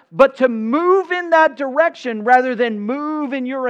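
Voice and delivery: loud, high preaching climax; rising sermon thunder; preaching at full force. Acted.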